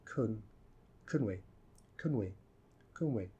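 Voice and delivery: CAN reduced to soft "kun", joined to "we"; low, soft, quick "kun we"; unstressed function words. Shown as a demonstration. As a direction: run "kun we" together without stress. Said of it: "so we don't stress them".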